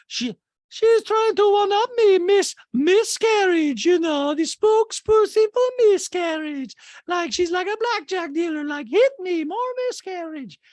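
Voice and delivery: very high, loud, drawn-out caricature; mocking quote. Host mocks a housewife's one-upping complaint, hammed-up high voice. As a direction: mimic her in a slow, piercingly high voice. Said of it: "She was like".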